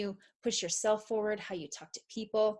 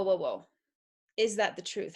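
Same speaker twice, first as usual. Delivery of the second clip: usual speaking voice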